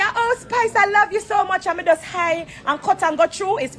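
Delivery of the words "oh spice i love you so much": higher, sugary mock greeting; pitched-up fake affection; mock fake sweetness. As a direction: imitate a two-faced friend gushing. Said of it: "So when me see you".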